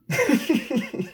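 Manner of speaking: a high burst of laughter; a real, amused high laugh. Real, not acted. Laugh after the vision.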